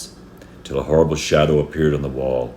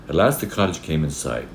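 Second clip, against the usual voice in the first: ordinary delivery, nothing marked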